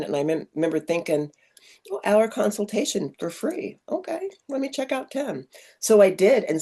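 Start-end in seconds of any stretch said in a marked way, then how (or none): 1.9-5.5 inner thought. Retold or imitated. Retelling her own thought.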